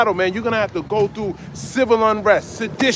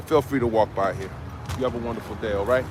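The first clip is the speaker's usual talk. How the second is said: soft friendly farewell; 9 semitones lower, 6 dB quieter, faster than usual. Real, not acted. Greeting a passerby softly.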